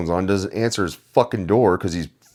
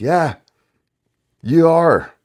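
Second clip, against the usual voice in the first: much higher and louder, gleeful put-down; mock verdict; 10 semitones higher, 5 dB louder, much slower than usual. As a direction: slam the answer home. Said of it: "And we can be like".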